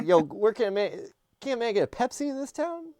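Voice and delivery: high, loud hammed-up call; mock shouted plea. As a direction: belt it out like a comic plea to the whole town. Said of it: "really getting mileage with that joke".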